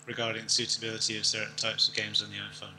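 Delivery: normal talking voice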